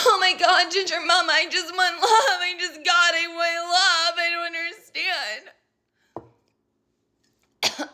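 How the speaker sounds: mock crying plea. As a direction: ham up a weepy plea for love. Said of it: "bitching and moaning and crying in the corner".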